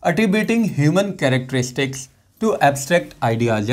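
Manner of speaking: slow, dictation pace; slow definition read. Shown as a demonstration. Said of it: "देखिए क्या होता है". (धीमे बोलकर परिभाषा लिखवाना)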